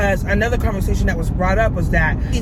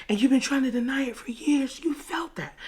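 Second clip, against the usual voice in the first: whispering; 6 semitones higher, 5 dB quieter than usual. Retold or imitated. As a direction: breathe it into her ear. His seductive words.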